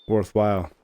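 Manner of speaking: normal talking voice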